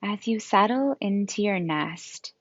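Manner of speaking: calm yoga nidra guidance with long, restful pauses; guided meditation. Acted. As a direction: gently lead them into rest, leaving space between phrases.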